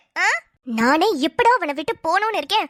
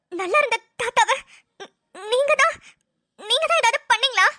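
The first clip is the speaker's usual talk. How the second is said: shocked accusation; 4 semitones higher than usual. Acted. Accuses her of harming grandfather.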